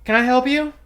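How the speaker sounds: high, loud imitation of a startled resident; loud confronting greeting. Woman challenging the delivery driver behind her, loud mock challenge.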